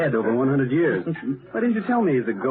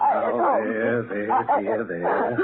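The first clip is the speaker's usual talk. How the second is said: soothing; 10 semitones higher, much slower than usual. Acted. Comforting the frightened woman.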